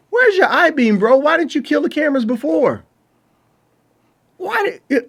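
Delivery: incredulous outburst. Real, not acted. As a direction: yell at the screen in disbelief. Yelling at the movie character.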